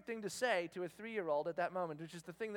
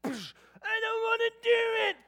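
put-on loud, high, drawn-out childish wail; tantrum whine; 13 semitones higher, 9 dB louder than usual. Grown daughter to boss at office, mock tantrum wail. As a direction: imitate a grown-up throwing a toddler fit. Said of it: "she's not falling down in her office".

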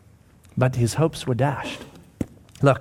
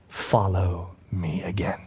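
very low, slow hush; tender invitation; 8 semitones lower, 8 dB quieter, slower than usual. Acted. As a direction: softly invite a friend back. Jesus calls Peter back.

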